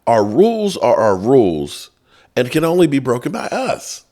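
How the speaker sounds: hammed-up bureaucratic decree; mock NCAA official. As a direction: lay down the law as a mocked official. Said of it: "who the N C double A has said".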